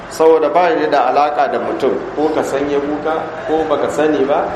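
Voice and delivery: usual speaking voice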